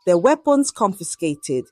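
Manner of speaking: ordinary delivery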